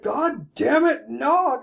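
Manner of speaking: hammed-up indignant yell; exasperated outburst. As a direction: imitate a grandpa caught off guard. Retold outburst of caught-out grandfather.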